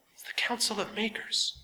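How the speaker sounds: high, soft awed whisper. A narrator's reading. Ratchet whispering to Artaban before the council. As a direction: breathe it out in awe. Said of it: "Redeman Ratchet whispered".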